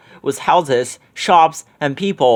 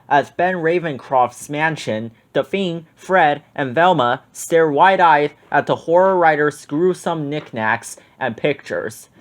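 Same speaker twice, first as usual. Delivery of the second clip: ordinary delivery, nothing marked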